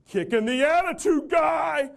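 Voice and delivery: slow, high, loud shout down the stairs; yell from above. Retold or imitated. Man on the second tier yelling down, high slow shout. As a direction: call it out across the floor, drawn out. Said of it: "yell down".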